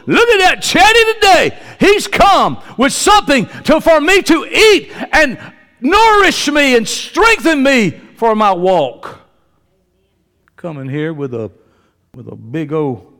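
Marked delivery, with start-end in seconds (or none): 0.1-9.2 high, loud, slow mock-delighted voice of the bread; voicing the bread. Pastor voices the bread welcoming a churchgoer, mock delight. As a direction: play the bread gushing over its guest. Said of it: "You should be".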